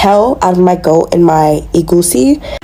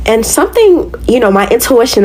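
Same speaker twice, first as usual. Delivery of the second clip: normal talking voice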